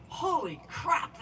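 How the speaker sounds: mock exasperated drawl; retold exasperation. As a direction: imitate her groaning at the climb. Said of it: "kept stopping and saying".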